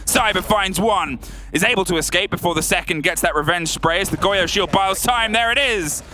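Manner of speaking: esports caster. Acted. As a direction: scream the clutch like a caster. Clutch round climax call.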